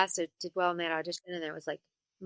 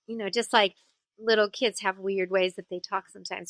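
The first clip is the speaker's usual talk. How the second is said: ordinary talk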